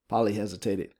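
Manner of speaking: ordinary delivery, nothing marked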